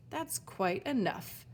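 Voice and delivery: slow, measured command; stern valet voice. Acted. Valet stops the quarrel from across the plaza, slow and firm. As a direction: halt the scene with authority.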